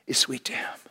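ordinary talk, nothing marked

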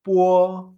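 "Puo" held loud on a level tone; first tone. Shown as a demonstration. Teacher modelling primer tono for learners, steady level demonstration. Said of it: "primer tono".

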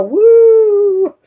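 loud high whoop of delight; excited whoop. Real, not acted. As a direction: cheer it out. Announcing paranormal questions.

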